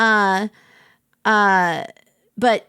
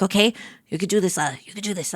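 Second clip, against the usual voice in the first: fast, hushed pep talk to yourself; quiet self pep talk; 5 semitones lower, 5 dB quieter, faster than usual. Retold or imitated. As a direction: psych yourself up under your breath. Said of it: "I, like, rally myself".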